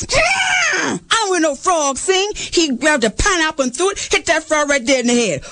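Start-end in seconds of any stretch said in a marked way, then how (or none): 0.1-2.3 high, drawn-out elephant holler; indignant elephant. A narrator's reading. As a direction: cry out in protest, stretching it high. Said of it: "the elephant jumped up".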